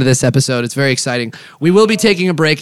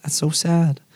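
ordinary talk, nothing marked

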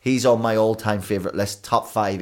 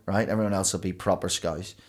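normal talking voice

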